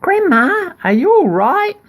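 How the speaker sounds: put-on very high, loud girl's call; little girl calling. Girl calling to grandma, high worried call.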